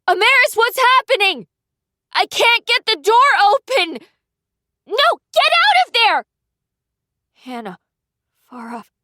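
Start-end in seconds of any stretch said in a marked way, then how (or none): 0.1-6.2 high, loud, frantic cries; panicked shouting. A narrator's reading. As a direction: yell in panic through the door. Sister shouts through fire.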